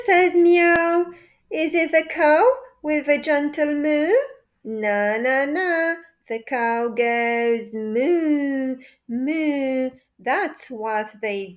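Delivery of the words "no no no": plain reading voice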